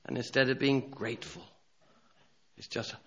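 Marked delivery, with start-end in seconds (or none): none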